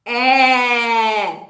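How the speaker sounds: "eh" called out loud and long; loud short e. Shown as a demonstration. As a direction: belt the "eh" like an elephant. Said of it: "It makes the short sound".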